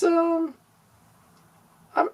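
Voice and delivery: normal talking voice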